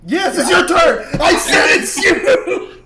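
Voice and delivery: yelling through laughter, high and loud; laughing shout. Real, not acted. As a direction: shout it while cracking up. Player missed his turn cue.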